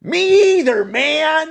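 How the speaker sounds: high, loud mock manager voice; hammed-up manager agreeing. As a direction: squeal it like an eager boss. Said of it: "your manager's like".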